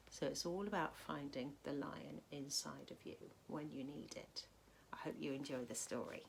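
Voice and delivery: ordinary delivery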